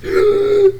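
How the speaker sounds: high, loud gasp for air; choking gasp; sharp gasp. Acted. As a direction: gasp as if the heart is seized. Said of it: "you clutch at your chest".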